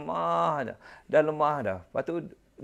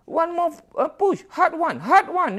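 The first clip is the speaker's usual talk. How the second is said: much higher, brisk nurse urging push; nurse's brisk voice; 10 semitones higher, slower than usual. Retold or imitated. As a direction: chirp like a bossy nurse at the bedside. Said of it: "dia kata".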